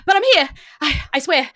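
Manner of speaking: high, loud, rushed apology; flustered bartender. Acted. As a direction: burst in late and out of breath. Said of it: "and he goes".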